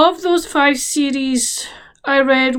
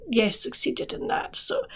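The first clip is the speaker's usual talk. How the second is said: whispering; 3 semitones lower, 12 dB quieter than usual. Real, not acted. Quiet aside ticking off goal.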